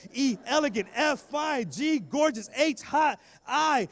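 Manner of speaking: high, loud, punched list; excited wooing. Retold or imitated. Boy describing the girl with adjectives, loud and eager. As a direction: belt out each compliment with mounting gusto.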